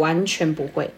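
dropped low and matter-of-fact; low blunt voice. Shown as a demonstration. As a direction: say it flat and low, no cuteness. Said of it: "没有塞奶的声音哎，直接低八度".

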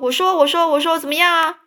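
put-on high, loud taunting boy voice; mocking dare. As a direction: dare him in a sing-song. Rival mockingly challenges.

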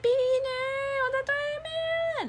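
拉高嗓门，朝马路对面喊; 像隔着斑马线叫朋友过来; 隔街喊人. Retold or imitated. Calling friend across the street. (在马路对面喊朋友)

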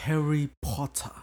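Snape. Retold or imitated. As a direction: imitate a drawn-out British "POT-tah". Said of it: "每次叫Potter都叫什么".

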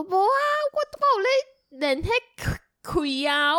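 mock old uncle refusing to leave; stubborn old uncle. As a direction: drag it out like an insistent grandpa. Retold uncle stubbornly insisting.

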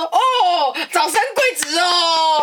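high, slow, beaming blessing from a father-in-law; cheerful old father. Retold or imitated. Father-in-law happily blesses the news, drawn out and bright. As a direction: stretch it out like a delighted elder. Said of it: "然后他爸爸就说".

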